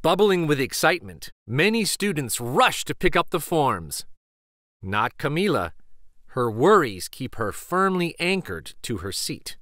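ordinary delivery, nothing marked

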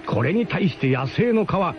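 normal talking voice

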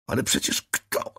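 whispered moan of dismay. A narrator's reading. Narrator moaning at the angel, whispered dismay. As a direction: breathe it out in despair. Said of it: "jęknąłem".

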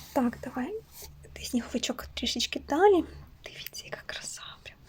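soft unvoiced whisper aside. Real, not acted. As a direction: whisper as if not to disturb the toy. Whispered aside while moving toy.

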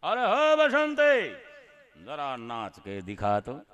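slow hammed-up Sholay villain voice; Gabbar Singh impression. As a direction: drag out the words like a filmi dacoit. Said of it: "ગબ્બરનો role લીધો".

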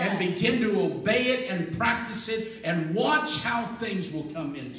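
loud, rising sermon delivery that swells toward a shout; a pastor pumping faith into a hurting crowd, loud and rising; full-force preaching. Acted. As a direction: preach it hard to the congregation.

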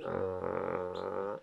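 puppy growl. Retold or imitated.

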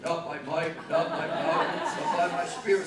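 Not by might said low and steady, pitch kept down. Shown as a demonstration. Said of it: "A little falsetto voice there".

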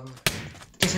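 ordinary talk, nothing marked

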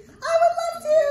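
high, loud excited puppet voice, partly sung. Acted. Puppet Arturo greeting the children, high excited voice. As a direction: bounce in as an eager little monkey puppet.

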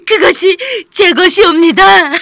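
high, loud, laughing with relief; overjoyed woodcutter. Acted. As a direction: burst out happily, nearly in tears. Woodcutter recognizes his axe.

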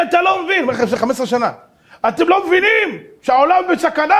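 high, loud urgent warning; alarmed warning. Retold or imitated. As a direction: urge him that the world is in danger. Philosopher warns of danger.

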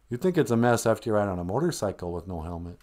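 ordinary talk, nothing marked

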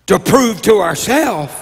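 ordinary talk, nothing marked

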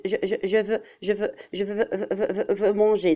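a teacher showing a stammer, stammering. Shown as a demonstration. Teacher to pupils, demonstrating a stammer. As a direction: stutter on je and veux, repeating each. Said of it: "comme celui qui dit".

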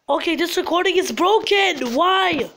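upset, high-pitched whining; distressed whine. Real, not acted. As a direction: wail in despair at broken tech. Recording breaks mid-game.

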